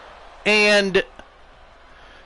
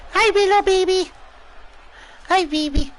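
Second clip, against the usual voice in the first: pet-greeting coo; 11 semitones higher than usual. Real, not acted. Commentator greeting the company mascot, high cooing baby talk. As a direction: coo hello in a much higher voice.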